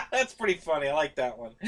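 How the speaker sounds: real amused laugh. Real, not acted. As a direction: chuckle while you talk. Laughing after joke.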